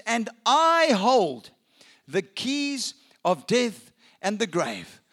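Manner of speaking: 'And I hold the keys' said very slowly; slow solemn. Acted. Risen Jesus to John, slow and solemn. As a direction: weigh each word of 'the keys of death'. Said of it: "he says".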